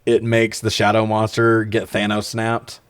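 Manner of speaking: ordinary talk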